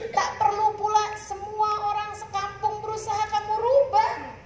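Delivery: preacher rousing the women to change; preaching at full force. Acted. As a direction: exhort the congregation from the pulpit.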